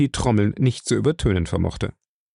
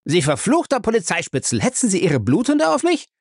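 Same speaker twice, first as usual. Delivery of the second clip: much higher furious outburst; raging; 9 semitones higher than usual. Acted. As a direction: rage at him, pitch soaring. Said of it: "tobte der Jüngling blass vor Wut". (wütend tobend)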